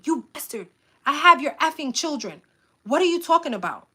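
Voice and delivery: high, loud furious yelling into the phone; angry scream. Retold or imitated. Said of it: "I screamed at him".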